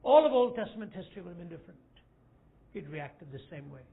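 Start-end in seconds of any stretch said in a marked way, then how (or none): 0.0-1.8 much louder, higher and fast; thundering climax. Acted. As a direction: hammer the whole line home. Repeated climax line.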